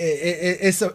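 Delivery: ordinary delivery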